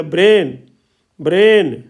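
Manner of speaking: "ब्रेन" with the ए stretched long, then falling; teacher modelling a long ए in "ब्रेन"; lengthened ए. Shown as a demonstration. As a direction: hold the vowel of "ब्रेन" as BREEHN, firmly. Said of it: "लंबा होता है".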